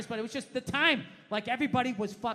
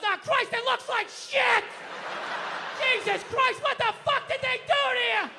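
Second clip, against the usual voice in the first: high, loud mock outrage at mom's haircut; dad shouting at mom in the car; dad yelling; 10 semitones higher, 3 dB louder than usual. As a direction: bark it like a blunt dad. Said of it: "he just goes".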